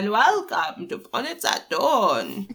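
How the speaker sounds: slow, spooky horror host welcome; lightly done creepy host intro; crypt keeper host. Pretend. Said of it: "I can be the crypt keeper".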